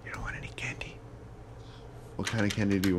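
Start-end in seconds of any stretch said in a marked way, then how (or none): 0.1-1.0 whispering. Real, not acted. Secret candy talk with child.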